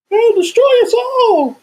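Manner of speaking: high, slow, loud doom cry; mock doom shout. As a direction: wail the prophecy of doom. Doom joke about Netflix.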